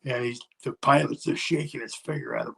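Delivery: ordinary talk, nothing marked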